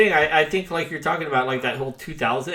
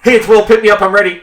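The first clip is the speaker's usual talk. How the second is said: loud, higher, hurried call into the payphone; shouted message; 7 semitones higher, 13 dB louder, faster than usual. Retold or imitated. Kid cramming a message into a collect call, loud and rushed. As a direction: blurt the whole message before it cuts off. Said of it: "so you just be like".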